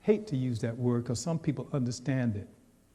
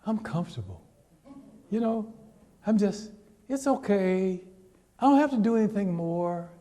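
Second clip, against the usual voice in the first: lazy comfortable person; 7 semitones higher than usual. Acted. A contented person brushing off change, lightly voiced. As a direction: play someone too cosy to move.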